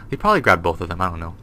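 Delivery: ordinary talk, nothing marked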